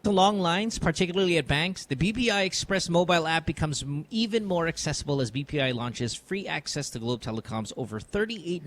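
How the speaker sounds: live radio sponsor read. Acted. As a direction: read the promo smoothly. Reads a sponsor ad.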